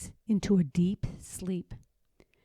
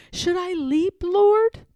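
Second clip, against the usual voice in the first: frantic cry; 9 semitones higher, 11 dB louder than usual. A narrator's reading. Panicked in wagon, asking God.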